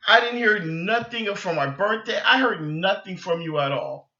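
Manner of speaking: ordinary delivery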